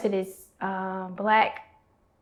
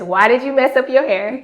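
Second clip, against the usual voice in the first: mock scolding mom, higher and louder; mom fussing; 4 semitones higher, 10 dB louder than usual. Hairstylist mom scolding her daughter, a mock fussing voice. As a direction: fuss at the kid like a hairstylist mom. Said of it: "will fuss at me".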